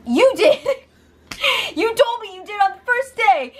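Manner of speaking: high, laughing mock accusation; laughing retort. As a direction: blurt it out through laughter, high up. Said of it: "And Kevin's like".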